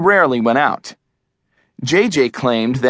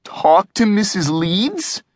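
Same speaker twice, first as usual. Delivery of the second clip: slow, high-pitched cringing question; mock-dreading question; 7 semitones higher than usual. A narrator's reading. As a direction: draw it out warily, rising at the end.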